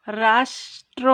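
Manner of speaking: slow demo splitting রাষ্ট্র into its syllables; syllables split. Shown as a demonstration. Teacher breaking রাষ্ট্র apart slowly, no doubling. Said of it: "উচ্চারণটাকে এখানে ভেঙে নিয়েছি".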